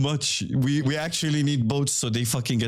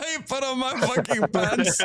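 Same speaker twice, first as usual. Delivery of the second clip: high pitched laughing repeat; 9 semitones higher than usual. Real, not acted. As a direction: laugh through the whole line. Laughing at teammate.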